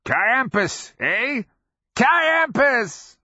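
very high, crazed cheering; mad congratulation. A narrator's reading. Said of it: "congratulating his dead fellows".

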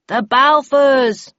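group toast response. A narrator's reading. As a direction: echo the toast loudly and slowly. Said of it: "We all repeated".

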